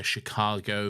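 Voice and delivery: ordinary talk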